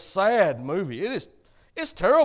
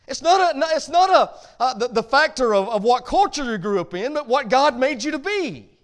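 rising into a louder preaching drive; 3 semitones higher, 6 dB louder than usual. Real, not acted. Pastor to congregation, pressing the point in a louder preaching voice. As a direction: press the point home to the church, building.

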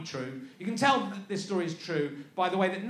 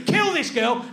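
mock angry order; 5 semitones higher, 6 dB louder, slower than usual. God ordering death.

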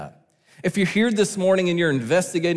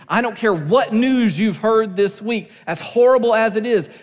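higher, louder sermon climax; impassioned preaching; 6 semitones higher, 4 dB louder than usual. Real, not acted. Preacher encouraging church facing horrible news, impassioned preaching. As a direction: drive it home from the pulpit.

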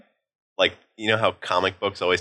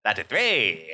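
put-on mid-Atlantic accent; much slower than usual. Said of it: "you with the mid-Atlantic accent".

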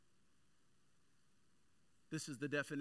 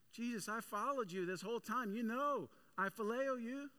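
pleading protest; 9 semitones higher, 3 dB louder than usual. Retold or imitated. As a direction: beg him to believe you. Peter protests his devotion.

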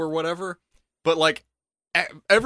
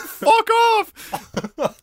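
mock retort. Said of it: "you're like".

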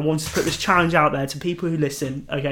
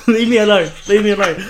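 laughing, louder, leave me alone; laughing protest; 4 semitones higher, 6 dB louder than usual. Real, not acted. Teased by his cohost, laughing as he protests. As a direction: laugh through a playful plea.

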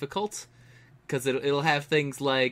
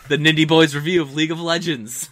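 loud, higher announcer wrap-up; mock show sign-off; 5 semitones higher, 11 dB louder than usual. Co-host mock-closing an accidental review, announcer voice. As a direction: announce it like the end of a segment.

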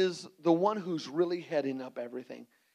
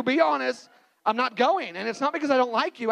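ordinary talk

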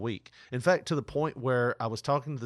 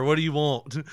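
louder, rude mock rep; 4 semitones higher, 10 dB louder than usual. Caller mimics scam rep's rude brush-off, louder. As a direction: imitate the rep's curt brush-off. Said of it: "But I love that, like, the".

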